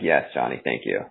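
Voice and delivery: low, soft, deadpan thanks; mock grateful. Podcast host imitating himself thanking a friend, low and dry. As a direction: murmur it low like a wry inside joke. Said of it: "I'm like".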